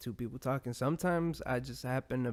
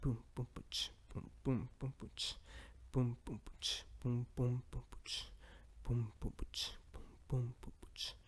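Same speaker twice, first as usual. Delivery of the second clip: soft beatbox loop with whispered snares; 8 dB quieter than usual. Pretend. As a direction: boom and ch like a beat machine. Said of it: "I wish I had a beat machine".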